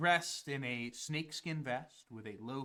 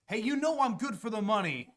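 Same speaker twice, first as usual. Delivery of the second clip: put-on pleading kid; 10 semitones higher, 9 dB louder, faster than usual.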